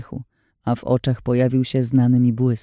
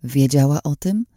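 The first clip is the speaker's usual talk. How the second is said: ordinary delivery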